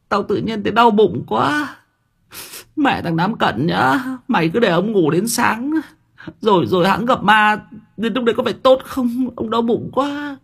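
whimpering whine. Acted. Quân scolding Nam Cận while whining in fear, near tears. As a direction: whimper it like a scared kid about to cry. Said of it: "mếu máo".

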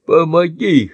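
hoarse plea. Acted. Beaten Andy begs his friend, hoarse plea. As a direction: rasp out a plea. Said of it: "хрипит он".

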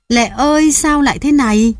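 high, anguished wail; wailing lament. A narrator's reading. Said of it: "Ông khóc mãi". (kêu gào thảm thiết)

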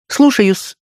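high-pitched maid's acknowledgement; obedient maid. A narrator's reading. Maid answers her mistress's order, high and willing. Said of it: "ответила горничная и весело побежала".

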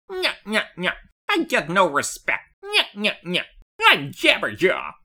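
Curly meets Dangerfield nyah-nyah; nasal stooge whine, nyah nyah; Jabberjaw voice. Retold or imitated. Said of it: "Curly from the Three Stooges by way of Rodney Dangerfield".

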